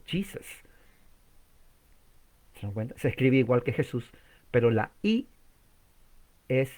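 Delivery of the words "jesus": JESUS as "JEE-sus", soft; English pronunciation. Shown as a demonstration. Teacher showing the English form, English pronunciation. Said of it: "en inglés".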